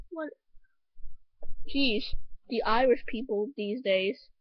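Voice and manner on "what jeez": normal talking voice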